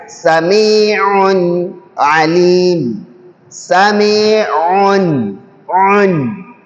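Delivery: "Sami'un" with its final n clear before "Alim"; "Sami'un, Un" with the n stressed; clear tanwin. Shown as a demonstration. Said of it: "end result of Tenwin is also Nun Sakina".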